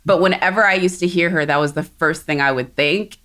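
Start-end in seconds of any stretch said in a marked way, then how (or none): none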